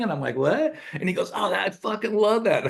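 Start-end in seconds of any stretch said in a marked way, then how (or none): 0.3-0.8 surprised. Retold or imitated. Retold baffled reply to excitement. 1.3-2.6 imitating an enthusiastic friend, high-pitched; retold gushing, high and keen; excited raving. Retold friend raving about lyric.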